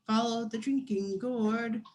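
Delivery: ordinary delivery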